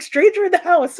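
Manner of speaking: husband spotting an intruder, loud and high; mock alarm. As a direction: sound the alarm.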